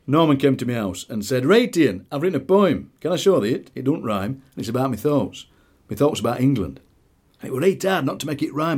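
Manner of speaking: lively dialect poem with Norman's eager voice; poem reading. Acted. Reads his poem aloud.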